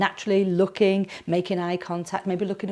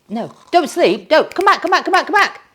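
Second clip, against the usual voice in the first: high, loud frantic calling back; mock panic; 9 semitones higher, 9 dB louder than usual. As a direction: urgently wake someone from a trance, hammed-up. Mock panic waking hypnotised viewer.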